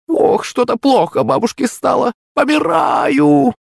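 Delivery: high, slow hammed-up dying groan; fake dying old woman. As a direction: overact being at death's door. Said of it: "Играла она на публику мастерски".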